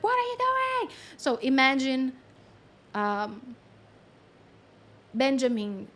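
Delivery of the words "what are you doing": mock alarmed protest. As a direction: squeal it as an upset animal would. Acts out an upset animal.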